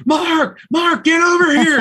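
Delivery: high, loud call from across a crowded room; retold shout across a room. Manager calling Mark over to the spirit box table, loud shout. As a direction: yell his name to get him over here fast.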